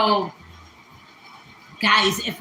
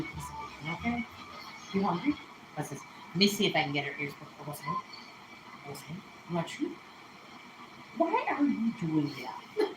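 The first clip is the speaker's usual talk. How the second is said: softer murmur to a pet; soft coaxing; 4 semitones lower, 12 dB quieter than usual. Real, not acted. To her old dog on her lap, soft coaxing. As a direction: coax the dog's ears up, gently and softly.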